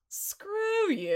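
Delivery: retelling a movie line as the callous principal; dismissive principal. Retold or imitated. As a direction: brush him off like a heartless principal. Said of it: "And the principal goes".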